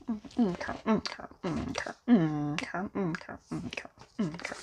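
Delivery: soft whispered okays. Real, not acted. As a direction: fade to a whisper. Whispering while shuffling cards.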